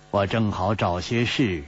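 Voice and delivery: plain reading voice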